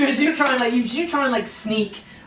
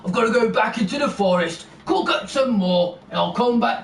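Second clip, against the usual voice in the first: bellowing merchant; 4 semitones higher than usual. Acted. Pig merchant bellows to the crowd that pies are sold out. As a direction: call it out over the crowd. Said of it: "the pig merchant bellows up to the crowd".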